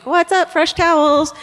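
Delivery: high sing-song door call; playful housekeeping holler; maid calling out. Acted. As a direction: announce yourself through the door, high and breezy.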